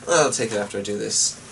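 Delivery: ordinary delivery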